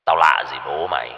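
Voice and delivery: low, cold taunt over a microphone; mock him calmly from above; low, menacing voice. A narrator's reading. Kidnapper taunting the captive over a mic, low and cold. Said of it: "cất giọng trầm trầm qua một chiếc micro".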